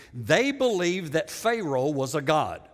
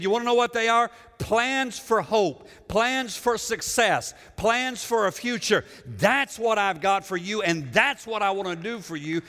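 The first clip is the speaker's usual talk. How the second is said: preacher voicing God, pitched up and ringing out; emphatic divine promise; 3 semitones higher than usual. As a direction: declare each promise forcefully as God's own words. Preacher voices God's promise with force.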